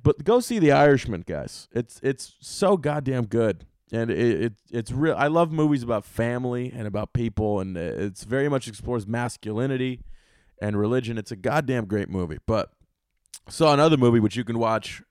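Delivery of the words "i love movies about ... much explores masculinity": ordinary delivery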